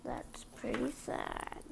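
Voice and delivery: soft breathy whisper of pity; whispered aside. Real, not acted. Narrator pauses the story to whisper sympathy, hushed. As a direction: murmur it under your breath. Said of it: "aside after sad scene".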